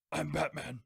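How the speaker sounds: rough whispery superhero impression; Batman. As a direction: do a mock superhero growl. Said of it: "She's gonna do Batman".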